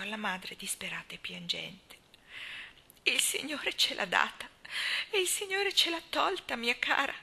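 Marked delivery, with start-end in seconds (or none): 3.0-7.1 a touch of tears, lifting high on the second phrase; consoling through mild tears; tearful. A narrator's reading. Old friend comforting the grieving mother, mildly tearful. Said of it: "disse con gli occhi pieni di lacrime".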